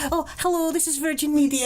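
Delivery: high, lilting Scottish operator; chirpy mock Scottish phone greeting; wee Scottish accent. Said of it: "wee Scottish accent".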